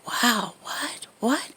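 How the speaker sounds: whispered, amazed "wow, what"; under his breath. Retold or imitated. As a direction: whisper it in disbelief, barely audible. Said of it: "like, under his breath".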